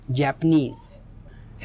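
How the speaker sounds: Japanese with a long, stressed final नीज; stress on last syllable. Shown as a demonstration. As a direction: stretch the end of Japanese, slowly. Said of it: "इसमें जो stress है वो last में होगा". (Japanese के आख़िरी हिस्से पर ज़ोर)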